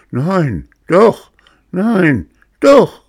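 from firm to shrieking high and loud; escalating shouting match. A narrator's reading. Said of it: "Immer hitziger wird der Streit".

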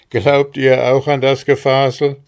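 loud, scornful challenge; hard. A narrator's reading. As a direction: throw it at her as a hard question. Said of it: "wiederholte der Bauer hart".

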